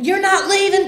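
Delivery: high, loud mock yell; retold screaming. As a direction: imitate a father yelling in protest. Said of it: "and starts screaming".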